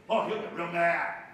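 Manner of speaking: normal talking voice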